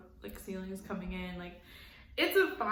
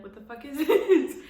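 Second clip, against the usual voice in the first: louder, higher, laughing through it; mock outrage breaking into a laugh; laughing shock; 6 semitones higher, 10 dB louder than usual. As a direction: act out your own disbelief, cracking up mid-line. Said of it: "I would be like".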